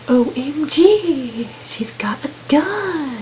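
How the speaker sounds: drawn-out, hushed mock OMG; mock alarm. Omid reacting to the woman's gun, drawn-out hushed mock alarm. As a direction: imitate slow-motion alarm, trailing into a whisper.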